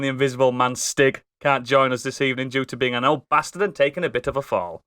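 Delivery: ordinary delivery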